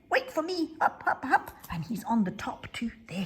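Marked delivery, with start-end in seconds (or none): 0.1-1.5 a small, high voice calling after the others; little Benny calls to the bears ahead, high and eager. Acted. Benny climbing.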